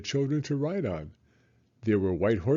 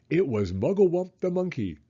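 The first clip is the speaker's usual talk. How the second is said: ordinary delivery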